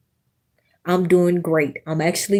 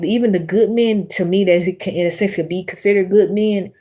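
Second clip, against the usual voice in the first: ordinary talk, nothing marked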